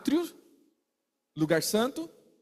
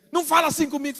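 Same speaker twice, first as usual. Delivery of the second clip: mock quarrel; 8 semitones higher, 5 dB louder, much faster than usual. Acted quarrel between two people.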